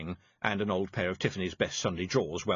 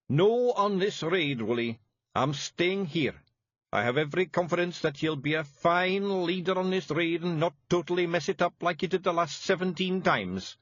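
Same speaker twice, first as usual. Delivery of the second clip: a hint of a firm Feegle chief; 8 semitones higher, 4 dB louder than usual. A narrator's reading. Rob stays behind.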